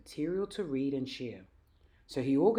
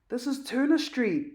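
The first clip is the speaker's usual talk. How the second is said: proud, beaming announcement, higher and louder; 11 semitones higher, 8 dB louder than usual. A narrator's reading. Dad shows Jack the historic street, beaming. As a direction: beam with pride as you say it. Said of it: "Dad beamed".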